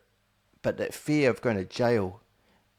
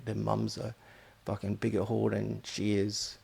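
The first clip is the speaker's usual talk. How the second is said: ordinary talk, nothing marked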